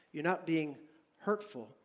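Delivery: normal talking voice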